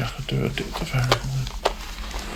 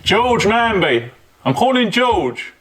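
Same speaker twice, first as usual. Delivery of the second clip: high drawn-out call upstairs; calling up to window; 6 semitones higher, 4 dB louder, slower than usual. Real, not acted. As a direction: call the name up, slowly. Calling spirit by name.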